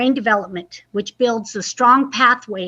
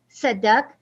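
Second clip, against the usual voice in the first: lively, expressive picture-book reading; reading to children. Acted. Librarian reading aloud to young children, lively storytime voice. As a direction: read it like story time for little kids.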